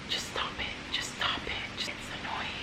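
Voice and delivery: exasperated whisper under the breath; whispered aside. Real, not acted. Fed up with people judging looks, whispered aside.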